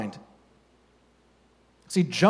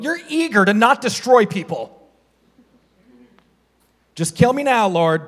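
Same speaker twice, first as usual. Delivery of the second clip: mock complaining Jonah; 3 semitones higher, 3 dB louder than usual. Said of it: "he complained to the Lord".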